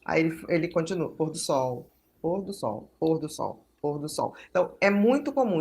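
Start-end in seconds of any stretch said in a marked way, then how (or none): 1.1-4.3 low, soft echo of the phrase; imitated echolalia. Child repeating the end of someone's sentence, imitated echolalia. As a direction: echo it mechanically, lower, with the same falling tune. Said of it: "ou então repetir o final da palavra".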